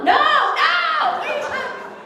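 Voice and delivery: very high shriek of alarm; hammed-up horrified protest; horrified denial. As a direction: squeal out a panicked no. Horrified at mom's misunderstanding.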